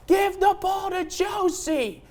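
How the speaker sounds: high, drawn-out yell imitating a rowdy fan; imitated fan yell. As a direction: holler it like the guy in the stands. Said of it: "He was literally yelling out".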